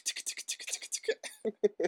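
unvoiced tick tick sounds; whispered ticking. Shown as a demonstration. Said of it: "it's like".

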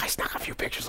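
imitating the wife's sneaky whisper; whispered aside. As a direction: hush it like sneaking photos. Wife sneaking pictures at signing.

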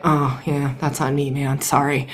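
low, soft sheepish apology; apologetic to dog. Retold or imitated. Owner apologizing to her dog, low and soft. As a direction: murmur a sorry, low and soft. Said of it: "I play this with my dog".

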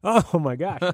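strained, still choking on a bug. Real, not acted. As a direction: gasp it out while choking. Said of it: "I just swallowed a bug".

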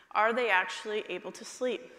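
normal talking voice

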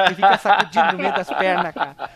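cracking up mid-sentence; laughing through the words. Real, not acted. Joking about giant boss anatomy.

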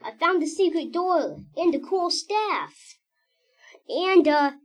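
excited. Acted. Falling through secret door.

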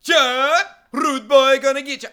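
high, loud title chant; big playful holler; mock hype shout. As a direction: belt it out like a hype man. Hyping the movie title.

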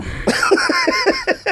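high-pitched giggle at own joke; high squealing laugh. Real, not acted. Teasing his co-host, a high squealing laugh.

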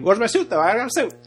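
imitated over-the-top fussing. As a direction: mimic an overacted whine about the suit. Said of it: "It's over the top".

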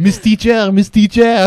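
high, mocking tattletale call; playful whiny-schoolkid impression; tattling pupil. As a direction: imitate a pupil telling on classmates. Mimicking waiter as tattling schoolchild.

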